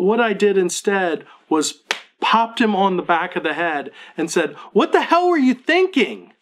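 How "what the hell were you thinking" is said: high, loud furious shout; dad snapping at his son after hitting him; angry yell. Retold or imitated. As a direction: yell it at your son out of fear. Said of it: "scream and yell at him".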